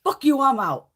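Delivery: loud retold kiss-off to the job; retold exit yell. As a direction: slam the door with it. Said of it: "and I went".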